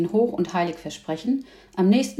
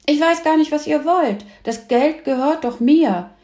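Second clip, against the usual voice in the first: put-on higher, louder, indignant boy; worked-up, raised-voice objection; indignant protest; 8 semitones higher, 5 dB louder than usual. A narrator's reading. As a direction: protest hotly that it's yours. Boy protests about his money.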